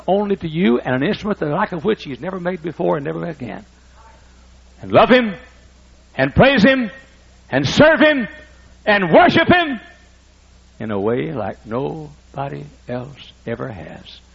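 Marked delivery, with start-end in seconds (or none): 4.8-9.8 each phrase pitched higher and louder, building up; rising preaching build. Real, not acted. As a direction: climb step by step to full preaching force. Preaching climax.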